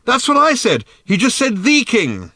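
high, loud exasperated yell; high-pitched shouted protest; shouting. A narrator's reading. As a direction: shout it over the din, stressing "the". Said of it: "shouted Ford".

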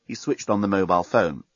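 ordinary delivery

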